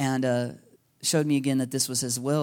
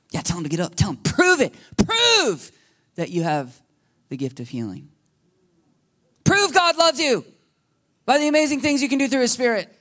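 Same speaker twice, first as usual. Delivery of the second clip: higher, louder needling taunt; goading enemy voice; 8 semitones higher, 4 dB louder than usual. Retold or imitated. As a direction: egg him on to prove himself. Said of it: "It's the enemy".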